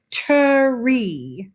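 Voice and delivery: "tree" split into "tuh-REE"; exaggerated two syllables. Shown as a demonstration. Teacher showing how some people say tree, exaggerated split. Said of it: "Sometimes we exaggerate when we speak".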